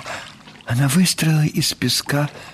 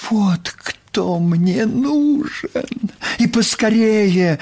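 put-on high, eager girl's exclamation; excited exclaim; 9 semitones higher, much slower than usual. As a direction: blurt it out with delight. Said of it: "воскликнула Нюша".

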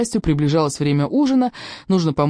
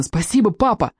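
put-on higher, grateful kids' exclamation; excited cheer; 5 semitones higher than usual. A narrator's reading. Boys thank dad for TV permission, cheering. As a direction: thank him with bubbling delight. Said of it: "закричали мы дружно".

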